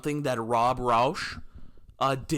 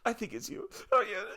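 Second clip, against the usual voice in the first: high, quavering fake cry; badly faked sobbing voice; hammed-up weepy squeak; mock tearful; 9 semitones higher, 3 dB quieter than usual. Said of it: "start bawling my eyes out".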